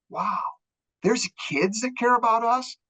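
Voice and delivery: high, awed voice of grateful parents; breathless awe at kids who care; amazed parents. Retold or imitated. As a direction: lift it up in wonder.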